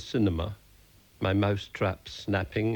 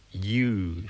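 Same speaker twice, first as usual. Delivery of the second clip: sneering. A narrator's reading. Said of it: "she sneered".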